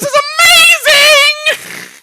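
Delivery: high, slow goblin exclaim; high drawn-out goblin cry; delighted goblin. Acted. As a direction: gloat like a thrilled goblin.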